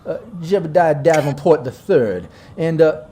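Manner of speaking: lightly voiced Dixie drawl; an easy Southern gentleman claiming his turf. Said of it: "my southern drawl got a little bit of that Dixie charm".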